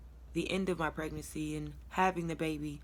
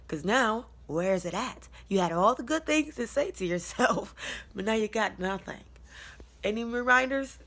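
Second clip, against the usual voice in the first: mocking self-challenge; 5 semitones higher, 5 dB louder than usual. Mocking herself, louder and higher.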